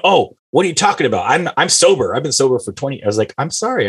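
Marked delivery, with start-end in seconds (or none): 0.0-3.0 high-pitched, put-out retort; mock indignation. Imitating a sober person snapping at an offer, mock indignant. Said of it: "you can just be nice about it". 3.4-3.9 mock apology. Host apologizing to the offended sober person, mock apologetic.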